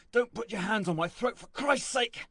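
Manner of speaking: exasperated high-pitched plea; urgent protest. A narrator's reading. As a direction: protest as if being choked. Carrier protests at being choked.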